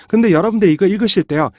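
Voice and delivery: usual speaking voice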